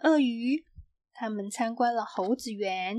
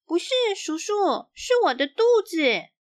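put-on higher, louder girl's voice; girl's answer; 7 semitones higher, 4 dB louder than usual. A narrator's reading. Xiaoqi answers the zookeeper in a high, louder girl's voice. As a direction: answer proudly like a little girl. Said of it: "小琪回答".

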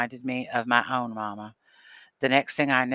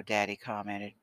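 ordinary delivery

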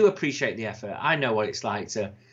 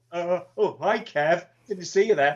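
mock surprise; 5 semitones higher than usual. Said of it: "He'd do an impression and go".

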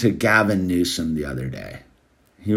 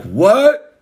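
sharp high startled what, louder; a quick jolt of surprise; surprised what; 11 semitones higher, 6 dB louder than usual. Retold or imitated. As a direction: blurt it out in disbelief. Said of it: "I was like".

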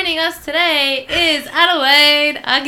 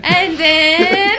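movie quote voice; 7 semitones higher, 8 dB louder than usual. Retold or imitated. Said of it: "Dude, where's my car?".

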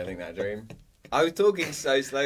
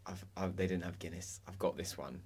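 soft, sheepish dad voice, lightly imitated; apologetic dad; 5 semitones lower, 13 dB quieter than usual. Dad apologising about the missing Guinness, soft and sheepish. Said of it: "he was kind of like".

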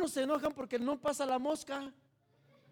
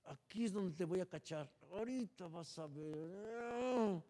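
scheming inner voice; 3 semitones lower, 6 dB quieter than usual. Retold or imitated. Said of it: "por dentro estás".

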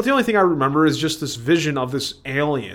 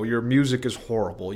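ordinary talk, nothing marked

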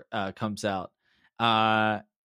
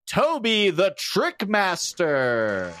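arena announcer; 6 semitones higher than usual. Acted. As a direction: announce the match to a cheering crowd. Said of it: "you hear an announcer".

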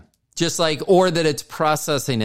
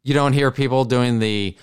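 ordinary delivery

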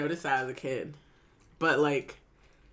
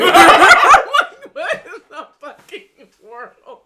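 laughing; 8 semitones higher, 13 dB louder, much slower than usual. Retold or imitated. Quoted reaction, laughing.

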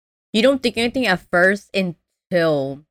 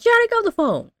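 high-pitched mock order; faster than usual. Doctor orders Janet to the phone, high mock exasperation. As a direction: voice shooting way up, imitated command.

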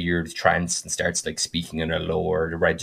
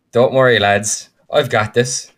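louder, cocky "I've got this"; confident reassurance; 4 semitones higher, 7 dB louder, slower than usual. Retold or imitated. Druid reassuring his panicked party mates, cocky and louder. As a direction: brush off their panic with swagger.

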